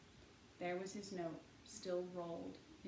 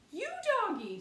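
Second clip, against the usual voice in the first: put-on very high, very loud child's scream; little girl screaming for her dog; 13 dB louder, faster than usual. A narrator's reading. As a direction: scream after the runaway dog. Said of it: "screamed Honey".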